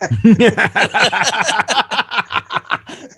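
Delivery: high, loud burst of laughter; long real laugh. Real, not acted. Laughing as he finishes the deli story. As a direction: breaks up laughing.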